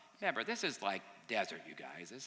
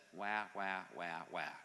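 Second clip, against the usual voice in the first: mock whining; 3 semitones lower, slower than usual. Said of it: "like normal, says".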